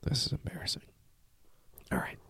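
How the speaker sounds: wet breathy whisper through real tears; barely voiced, spent from crying. Real, not acted. Son after crying over a message to his dad, whispering tearfully. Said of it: "I got tears all over my glasses".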